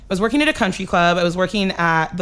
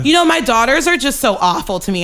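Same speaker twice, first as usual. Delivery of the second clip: mock passive-aggressive mother, pitched up; 5 semitones higher, 4 dB louder than usual. Mother complaining about her daughters on a call, mock passive-aggressive. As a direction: imitate a wounded guilt-trip, a little higher. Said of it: "said some passive aggressive shit".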